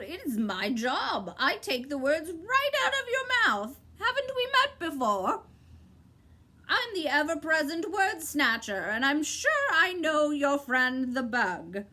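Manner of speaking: high, clipped bird's reply; sharp bird voice. A narrator's reading. Bird cutting Milo off, high and sharp. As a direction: snap it out like a bird.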